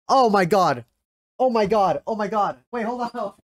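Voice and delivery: ordinary delivery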